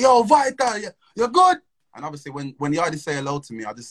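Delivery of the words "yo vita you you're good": higher, louder cheery greeting from a stranger; imitating the yardie. Stranger greets him at the car, cheery mock greeting. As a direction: call out a friendly yo like the stranger did. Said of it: "he's like".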